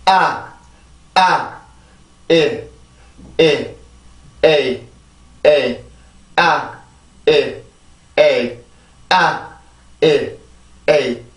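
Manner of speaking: 아, 일, 에이 as separate stressed beats, each falling; word rhythm drill. Shown as a demonstration. Teacher drilling a word's rhythm for learners, evenly separated beats. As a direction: chant each vowel beat 아, 일, 에이 on its own. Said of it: "이게 이 단어의 리듬이에요".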